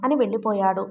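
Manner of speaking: ordinary delivery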